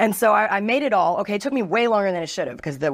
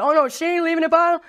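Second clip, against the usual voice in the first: higher, casual bro voice; mock bouncer; 10 semitones higher than usual. Bouncer answering the coworker, high mock bro voice. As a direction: play the bouncer shrugging it off. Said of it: "he was like".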